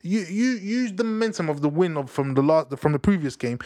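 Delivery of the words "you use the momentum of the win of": ordinary delivery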